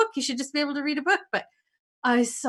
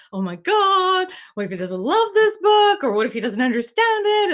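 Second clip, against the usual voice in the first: high, loud anxious panic; retold rush of nerves; 8 semitones higher, 6 dB louder than usual. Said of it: "I am in an absolute state of anxiety".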